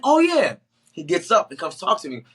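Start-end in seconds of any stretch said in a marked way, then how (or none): 0.0-0.6 high, loud burst of remembering; acted sudden recognition. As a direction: burst out as if it just clicked.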